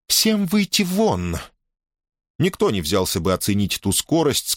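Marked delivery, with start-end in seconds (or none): 0.1-1.4 high, slow, commanding princess voice; emphatic princess command. A narrator's reading. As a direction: order everyone out, slowly and pointedly. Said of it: "подчёркнуто выразительный голос принцессы".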